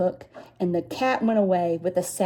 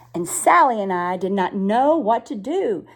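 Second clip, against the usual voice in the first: ordinary delivery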